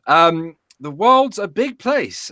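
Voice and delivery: ordinary delivery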